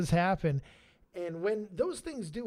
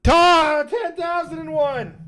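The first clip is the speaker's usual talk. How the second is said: triumphant shout; 11 semitones higher, 11 dB louder, slower than usual. Real, not acted. Announces ten thousand milestone.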